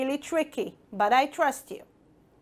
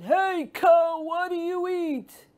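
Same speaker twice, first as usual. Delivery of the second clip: frog shouting; 5 semitones higher than usual. Acted. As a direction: holler at the cow. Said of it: "The big mouth frog shouted".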